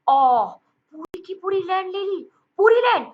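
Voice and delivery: high-pitched astonished exclamations, loud bursts; mock amazement. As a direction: gasp it out as if stunned by someone's wealth. Acting amazed at someone's land purchase. (हैरानी का नाटक, ऊँची आवाज़ में)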